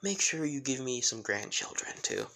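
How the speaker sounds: ordinary delivery, nothing marked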